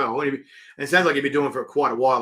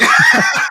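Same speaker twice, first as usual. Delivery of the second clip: shouted laugh; 11 dB louder than usual. Real, not acted. Laughing after his hype yell.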